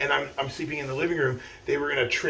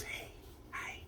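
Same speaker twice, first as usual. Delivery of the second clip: much softer, a gently imitated hushed greeting; imitating grandma softly; 21 dB quieter than usual. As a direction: say it softly like a grandma not wanting to startle a waking kid. Grandma greeting a child waking.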